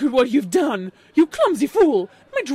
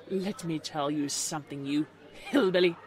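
loud, lower-pitched haughty put-down; snobbish contempt; 4 semitones lower, 6 dB louder than usual. A narrator's reading. Snobbish unicorn insults Applejack.

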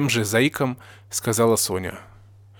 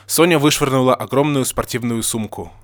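ordinary delivery, nothing marked

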